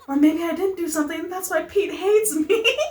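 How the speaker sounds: high, fretful wail; Pelly. Acted. Pelly worries Pete hates her, high and despairing. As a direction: rise into mock despair over a crush.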